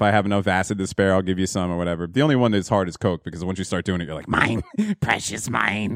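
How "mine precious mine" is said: greedy Gollum-like voice. Retold or imitated. Greedy coke user voiced.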